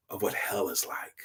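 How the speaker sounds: hushed whisper of a grim truth. A narrator's reading. Angel telling the man about hell, whispered. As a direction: whisper it gravely.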